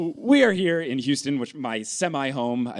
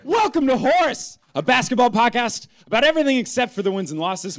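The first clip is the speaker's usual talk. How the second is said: announcer voice; 9 semitones higher than usual. Acted. Show opening announcement.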